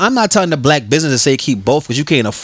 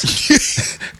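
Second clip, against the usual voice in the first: an airy, voiceless high laugh; a silent wheeze of a laugh; breathy laugh; 14 semitones higher than usual. Real, not acted. Laughing mid-quote.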